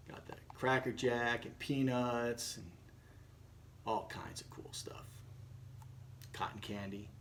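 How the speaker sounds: soft, low whisper; a secret murmured aside. Real, not acted. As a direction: lean in and whisper it. Narrator aside to listeners.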